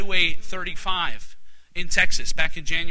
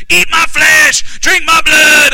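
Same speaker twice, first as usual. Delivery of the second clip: loud, high, drawn-out Southern holler; hammed-up shouted quote, word by word; Southern preacher shout; 8 semitones higher, 8 dB louder, much slower than usual. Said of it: "It's that Southern thing".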